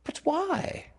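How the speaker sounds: put-on very high girl's question; puzzled Wendy. A narrator's reading. Wendy asking why Tink is mean, high and puzzled. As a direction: ask it in a high, plaintive girl's voice.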